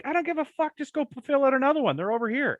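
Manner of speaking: high, careless nurse voice mocking. Vax story, careless nurse waving him in, high and mocking. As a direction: toss it off high like a bored nurse.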